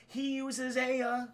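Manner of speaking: ordinary talk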